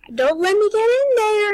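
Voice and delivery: high, loud threatening shout; menacing yell. Retold or imitated. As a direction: roar it at the locked car. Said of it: "He starts, like, going".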